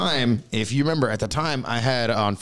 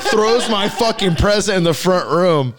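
laughing; 6 semitones higher, 4 dB louder than usual. Real, not acted. Laughing while retelling.

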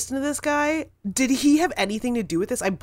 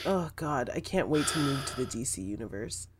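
lower, softer, muttered mock Keaton; grumbling Michael Keaton; 9 semitones lower, 10 dB quieter, faster than usual. Keaton stuck reading stupid lines in a booth, lower and softer. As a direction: grumble it under your breath like a bored star. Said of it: "He's like".

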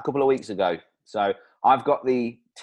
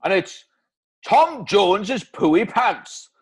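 slow, drawn-out Welsh product name; Welsh accent; 4 semitones higher, slower than usual. Acted. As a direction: announce it like a Welsh crooner. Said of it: "Bloody Welsh accent again".

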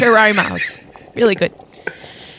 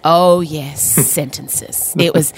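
ordinary delivery

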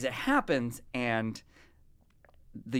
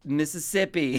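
Southern accent; 5 semitones higher, 4 dB louder, slower than usual. Shown as a demonstration. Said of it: "same thing with Southern accents".

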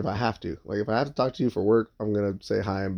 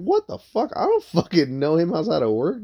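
high-pitched, incredulous retort; retold outrage, pitch jumping up sharply; indignant disbelief; 6 semitones higher than usual. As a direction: protest in disbelief, acting out the comeback. Retells indignant denial to the partner.

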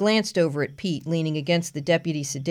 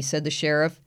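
ordinary delivery, nothing marked

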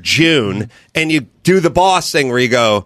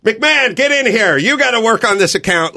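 boss calling out; 10 semitones higher than usual. Retold or imitated. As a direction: boss calling an employee in, higher. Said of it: "and then he'll be like".

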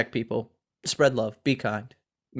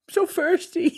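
so firsty laughed out much higher; laughing imitation; slower than usual. Cohost mocking the hashtag like a toothless kid, laughing. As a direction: giggle through so firsty mockingly. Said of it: "Sounds like my daughter without her teeth".

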